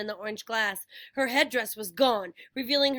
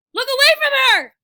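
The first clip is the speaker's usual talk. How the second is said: high, loud, fast urgent shout; 13 semitones higher, 14 dB louder, faster than usual. A narrator's reading. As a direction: yell a warning to friends. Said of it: "Annabeth shouted".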